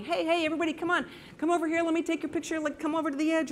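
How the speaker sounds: high, fast calling-out voice; retold yelling. Photographer calls fishermen over from the pier, high and fast. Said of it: "I'm yelling".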